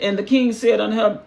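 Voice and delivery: ordinary delivery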